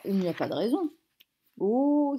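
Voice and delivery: ordinary delivery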